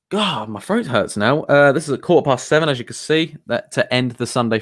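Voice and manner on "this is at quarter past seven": ordinary delivery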